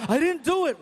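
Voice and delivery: put-on high, loud defensive kid voice; protesting boy. Son denying blame to stepdad, loudly defensive. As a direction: shout the denial like a panicked kid.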